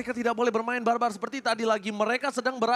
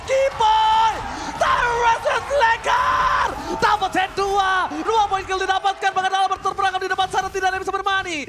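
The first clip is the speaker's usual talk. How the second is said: much higher, loud hype yelling; excited shout; 8 semitones higher, 5 dB louder than usual. Real, not acted. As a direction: scream the highlight play. Caster hypes a double kill.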